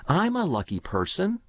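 put-on high, confident child's voice; stout boyish declaration. A narrator's reading. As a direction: declare it with mild childish defiance. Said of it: "he said stoutly".

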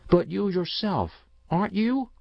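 put-on high-pitched, rising child's question; insistent young boy. A narrator's reading. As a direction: press mother like an anxious child. Boy presses mother about her luck.

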